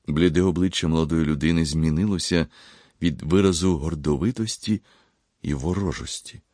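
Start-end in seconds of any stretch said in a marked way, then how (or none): none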